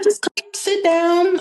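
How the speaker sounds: slow coaxing to a nervous friend. Retold or imitated. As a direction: ease a stressed friend into a chair. Retells coaxing her nervous friend.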